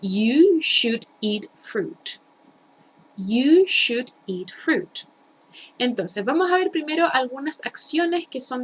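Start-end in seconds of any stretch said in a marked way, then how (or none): 0.0-2.2 You should eat fruit, said very slowly word by word; slow model sentence. Shown as a demonstration. Teacher modelling an example sentence very slowly. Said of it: "puedo decirlo de la siguiente manera". 3.1-5.1 You should eat fruit, again very slowly and evenly; slow repeated model. Shown as a demonstration. Teacher repeating the example slowly for the class. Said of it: "puedo decirlo de la siguiente manera".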